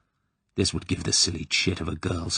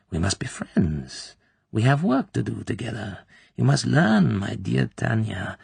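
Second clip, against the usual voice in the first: a lightly voiced, syrupy coaxing tone, with hushed endearments; oily and smooth; 4 semitones higher than usual. Klebb coaxing frightened Tatiana to trust her, oily and smooth. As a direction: coax her like a false mother. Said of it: "The voice became oily and smooth".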